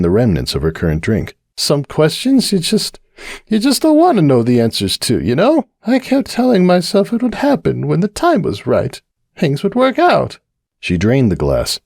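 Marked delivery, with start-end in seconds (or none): 1.6-10.4 high, tipsy, worried mare voice; Cupcake confiding. Acted. Cupcake confiding her fears to the bartender, high and worried. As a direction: pour out the worry, a little tipsy.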